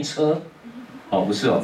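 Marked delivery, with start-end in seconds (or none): 0.0-0.4 the character read as 车 instead of 路; wrong reading 车. Retold or imitated. Teacher quoting students who read the character as 车. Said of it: "边念边念".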